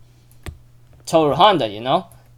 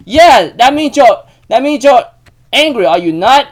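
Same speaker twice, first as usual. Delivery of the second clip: acted angry retort; 7 semitones higher, 7 dB louder than usual. Yuki needles Manabe about being angry, acted angry retort. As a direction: press the point, stretching the words. Retold quarrel, pressing the accusation.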